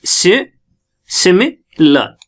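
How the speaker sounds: Similar broken into "si, simi, la" with pauses; syllable by syllable. Shown as a demonstration. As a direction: sound out similar in separate pieces, ending on "la". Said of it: "ये कितने syllable का बना है".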